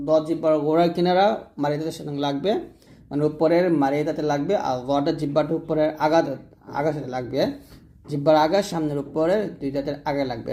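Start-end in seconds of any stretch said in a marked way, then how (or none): none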